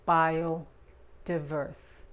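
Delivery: "Biodiverse" very slowly, short I and English R; slow syllables. Shown as a demonstration. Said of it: "La I es corta". ("Biodiverse" lento, I corta y R inglesa)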